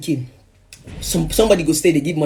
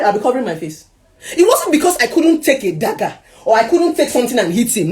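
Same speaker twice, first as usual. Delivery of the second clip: normal talking voice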